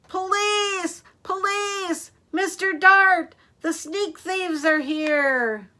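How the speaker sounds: high, loud, drawn-out cry for help; loudest terrifying shout. A narrator's reading. Stanley calls police on the sneak thieves, terrifying shout. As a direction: yell it out to raise the alarm. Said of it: "he shouted in his loudest, most terrifying voice".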